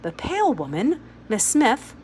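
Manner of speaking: plain reading voice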